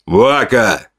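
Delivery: mildly thundering chief; thunderous command. A narrator's reading. Chief cuts off raging father, mildly thunderous. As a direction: call the name as a stern command. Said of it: "голос вождя ударил как гром".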